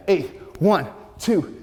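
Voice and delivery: ordinary talk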